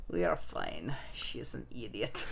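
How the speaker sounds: put-on low, quiet Russian accent. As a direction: mutter it in a thick Russian accent. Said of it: "my stupid Russian accent".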